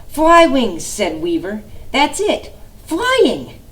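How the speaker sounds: high, loud eureka; excited idea. Acted. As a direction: burst out with the idea. Weaver has an idea.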